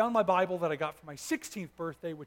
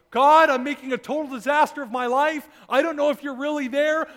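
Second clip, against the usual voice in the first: desperate prayer; 7 semitones higher, 10 dB louder than usual. Retold or imitated. Desperate prayer retold in car.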